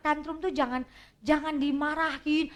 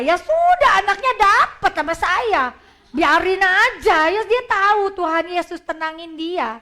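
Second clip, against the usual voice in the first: 1 excited; 4 semitones higher, 8 dB louder than usual. Real, not acted. Excited retelling of method.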